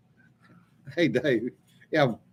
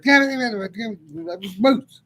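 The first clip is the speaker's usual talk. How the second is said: high, frantic yeahs that land on moose; excited yelling; 9 semitones higher, 3 dB louder, slower than usual. Retold or imitated. Passenger alerting the driver to a moose, high and frantic. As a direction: blurt it out like you just saw a moose. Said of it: "starts going".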